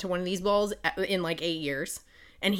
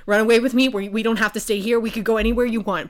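louder, fast pleading; urgent plea; 3 semitones higher, 7 dB louder, faster than usual. Retold or imitated. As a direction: beg her to flee with you. Max pleading with her.

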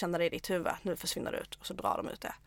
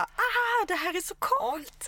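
much higher, thrilled shout that stretches out slowly; retold excited yell; 10 semitones higher, much slower than usual. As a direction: relive the euphoric car scream. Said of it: "satt i bilen efteråt och bara typ skrek". (glatt upprymt skrik, ljust och utdraget)

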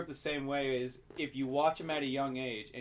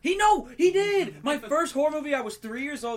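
excited; 12 semitones higher, 9 dB louder than usual. Real, not acted. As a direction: protest with delight, much higher. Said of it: "excited insistence".

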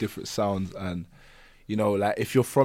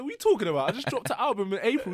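retold incredulous reply; 8 semitones higher, faster than usual. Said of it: "I said".

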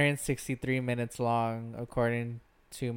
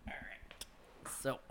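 whispering; 14 dB quieter than usual. Real, not acted. Whispered start of segment.

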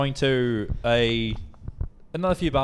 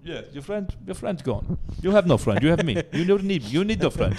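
higher-pitched mock Russian heavy; Russian accent; 5 semitones higher than usual. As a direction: play a Russian mobster with a put-on accent. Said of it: "Back to Russia".

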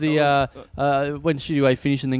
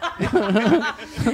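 high laugh; 7 semitones higher than usual. Real, not acted. Big laugh after joke.

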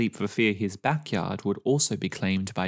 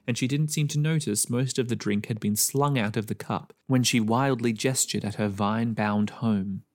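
plain reading voice

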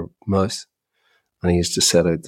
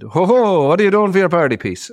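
higher, louder, fast jolly compère; mock host voice; 8 semitones higher, 5 dB louder than usual. Mocking the Rose of Tralee host quizzing a contestant, jolly and fast. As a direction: ham up a chirpy TV host. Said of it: "your man said".

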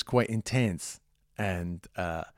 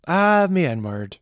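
put-on slow stage-Irish leprechaun accent, louder; 5 semitones higher, 6 dB louder, much slower than usual. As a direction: drawl it like a cartoon leprechaun. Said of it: "would sound like a racist leprechaun".

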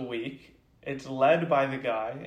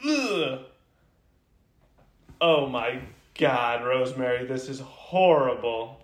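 mock outrage at bad cooking; disgusted boyfriend; 5 dB louder than usual.

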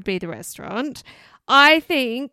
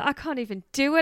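usual speaking voice

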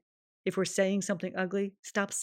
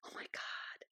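breathless whispered awe; awed whisper at the mirror; 17 dB quieter than usual. Pretend. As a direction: whisper it to your reflection. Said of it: "look ourselves in the mirror and say".

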